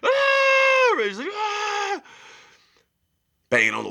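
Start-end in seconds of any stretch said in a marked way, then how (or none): 0.0-0.9 long, high, very loud scream; imitated scream. Showing how it sounded like murder next door, imitated scream. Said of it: "screaming at the top of his lungs". 1.3-2.0 long high-pitched mimicked scream; imitated scream. Retelling screams through the motel walls, imitated high scream. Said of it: "screaming at the top of his lungs".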